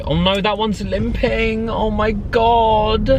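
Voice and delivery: high, drawn-out distressed cry; alarmed dismay. Real, not acted. Spotting a limping crow in the road, high alarmed wail. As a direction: wail it high and stretched with concern.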